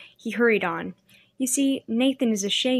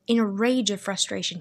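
usual speaking voice

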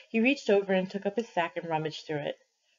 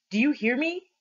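raised, higher yell; 6 semitones higher, 4 dB louder than usual. A narrator's reading. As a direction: yell it up at him. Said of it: "he yelled".

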